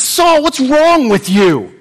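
a grieving brother shouting at Saul; angry brother Seneca. Acted. Seneca calls out to Saul, high and accusing.

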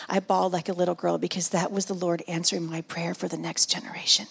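ordinary delivery

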